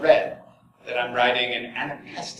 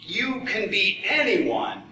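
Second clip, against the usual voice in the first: normal talking voice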